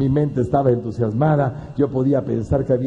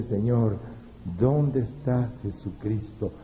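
sad plea; 3 semitones lower, 8 dB quieter, much slower than usual. Retold or imitated. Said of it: "con gran tristeza".

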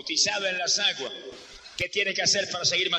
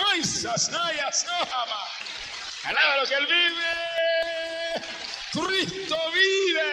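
high, slow, stretched cries ending in a shout; ecstatic preaching; 9 semitones higher, much slower than usual. Real, not acted. Preacher breaks into ecstatic tongues and shouts praise to the church. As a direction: burst into Spirit-filled praise.

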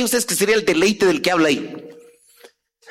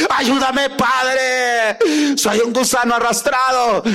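mock desperate shout; 3 semitones higher, much slower than usual. Preacher mocking a needy worshipper shouting to God. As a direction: wail it like a groveling worshipper. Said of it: "él sigue gritando".

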